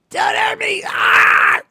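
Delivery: high, hammed-up plea ending in a yelp; mock scream. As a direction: shriek it like a melodramatic victim. Mocking the screaming man.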